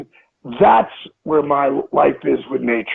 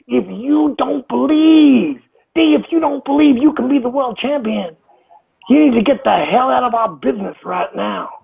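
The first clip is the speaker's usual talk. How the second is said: higher-pitched, heated coach reprimand; heated retold rant; 6 semitones higher than usual. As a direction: relive the coach losing his temper, a notch above normal. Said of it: "he got hot".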